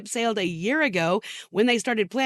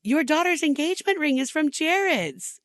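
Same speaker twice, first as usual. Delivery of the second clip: condescending quote; 7 semitones higher than usual. Retold or imitated. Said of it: "Or, like when she said".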